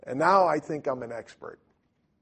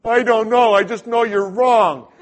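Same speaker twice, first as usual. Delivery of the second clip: loud, higher, stubborn retort; defensive outburst; 8 semitones higher, 13 dB louder than usual. Retold or imitated. Arguing with the elder, loud stubborn retort. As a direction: dig in and shout him down. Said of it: "And I'm going".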